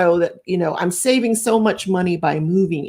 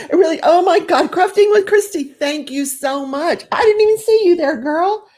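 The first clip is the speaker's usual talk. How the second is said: much higher, louder gushing thanks; excited thanks; 10 semitones higher, 3 dB louder than usual. Real, not acted. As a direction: squeal with delighted surprise at a donor. Greeting a viewer's super chat.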